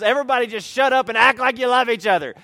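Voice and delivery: put-on loud, fast hissed order to kids; mom snapping. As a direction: bark it fast before the door.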